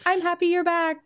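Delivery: high chirpy pet voice; little creature voice. Acted. Candor greeting freed victims, high and chirpy. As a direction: squeak it like a happy little pet. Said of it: "to be like".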